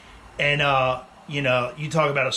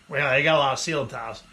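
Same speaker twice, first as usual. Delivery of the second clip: ordinary talk, nothing marked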